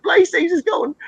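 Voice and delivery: hammed-up English-accented wailing; mock crying. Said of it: "they're all sitting around crying".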